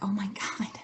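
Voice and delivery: breathy near-whisper of dismay; hushed retold dismay. Coach recalling getting no clients, hushed dismay. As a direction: quietly gasp it, imitating past dismay.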